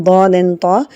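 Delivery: normal talking voice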